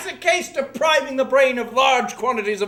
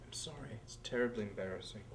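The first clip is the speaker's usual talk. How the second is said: hushed aside; 14 semitones lower, 26 dB quieter, faster than usual. Acted. Apologetic aside to host.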